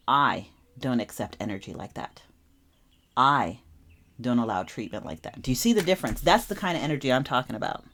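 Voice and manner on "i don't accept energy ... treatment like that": calm and firm. Retold or imitated. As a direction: calmly draw the line without attacking. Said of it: "The difference is".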